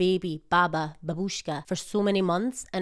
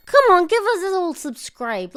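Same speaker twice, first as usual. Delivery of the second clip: playful coaxing; 10 semitones higher, 7 dB louder than usual. Real, not acted. Coaxing viewers to subscribe.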